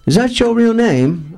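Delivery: high, slow put-on questioning voice. Rock singer walks up to ask, imitated high voice. Acts [name]'s question to him.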